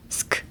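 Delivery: a bare voiceless sk; whispered sk. Shown as a demonstration. Teacher isolates the sk sound. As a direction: hiss out sk with no vowel.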